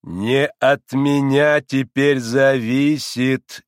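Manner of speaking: loud, very slow, weighty dismissal; pompous, impatient doctor. A narrator's reading. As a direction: brush him off with drawn-out emphasis. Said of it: "нетерпеливо проговорил доктор".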